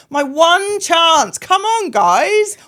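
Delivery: high, loud, slow exasperated rant; hammed-up outrage at the universe; mock fuming. Retelling her fury at the lorry, hammed-up high exasperation. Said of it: "I was fuming".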